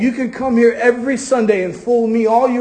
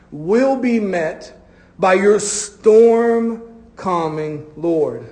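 slow emphatic; much slower than usual. Real, not acted. Preacher slowing for the climax.

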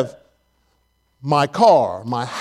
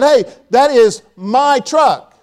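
slow, higher, firm protest; mock upset claim; 6 semitones higher, 4 dB louder, slower than usual. Preacher retells telling a stranger the truck is his, slow and higher. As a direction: plant your claim slowly at him. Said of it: "started getting kind of upset".

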